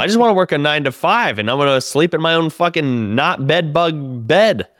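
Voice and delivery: mock-desperate, high-pitched pleading; despairing whine. Comic retelling his pre-show despair, high whining plea.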